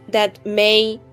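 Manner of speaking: normal talking voice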